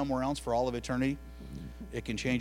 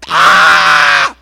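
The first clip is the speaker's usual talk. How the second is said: huge loud cartoon yelp; cartoon scream; 3 semitones higher, 23 dB louder than usual. Mom's shocked yelp at the slammed door, cartoon scream. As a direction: let out a wild cartoon "Ah!". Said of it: "like a cartoon, I went".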